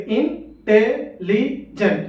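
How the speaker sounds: Intelligent in four slow, spaced beats; syllable by syllable. Shown as a demonstration. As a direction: separate every syllable of intelligent: in, te, li, jent. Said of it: "चार बार लगा".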